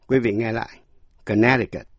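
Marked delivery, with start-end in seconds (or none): 1.2-1.9 Connecticut with its second C silent; silent middle C. Shown as a demonstration. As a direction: say Connecticut as "kuh-NET-i-kut", no K before the T. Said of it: "âm C trong âm tiết N E C câm không".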